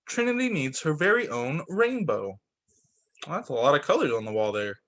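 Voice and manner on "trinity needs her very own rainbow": ordinary delivery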